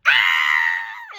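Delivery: loud, drawn-out scream; re-create a scream of shock; reenacted scream. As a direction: scream "ah" for the story. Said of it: "screamed so loud".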